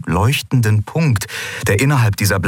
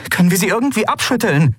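fast, high, pressing question; hurried and high-pitched urgency; urging; 12 semitones higher, faster than usual. A narrator's reading. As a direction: press for an answer, quickly. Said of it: "drängte sie Aylan".

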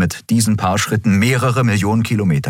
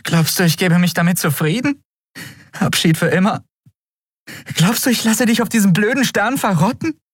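higher, upset challenging questions; angry tearful woman; 13 semitones higher than usual. A narrator's reading. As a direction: throw it at him in anger. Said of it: "ärgerlich".

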